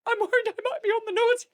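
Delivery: put-on high, fast, fretful child voice; worried little boy. Six-year-old son at Santa's door, high anxious child voice.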